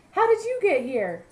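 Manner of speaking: high, loud amazed little caterpillar; a small creature wide-eyed with wonder; eager caterpillar. Acted. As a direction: caterpillar asking in excitement. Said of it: "said Caterpillar".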